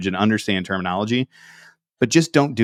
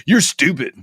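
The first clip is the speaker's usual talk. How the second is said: mock blunt insult; 6 semitones higher than usual. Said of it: "be like".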